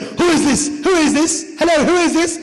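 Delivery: high, agitated British voice; British accent. Retold or imitated. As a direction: demand answers as a flustered Brit. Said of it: "British voice".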